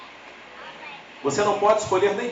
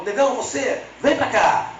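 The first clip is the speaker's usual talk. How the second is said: gruff sergeant; 8 semitones higher, 4 dB louder, slower than usual. Retold or imitated. Sergeant voiced in the story.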